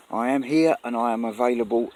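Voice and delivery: ordinary talk, nothing marked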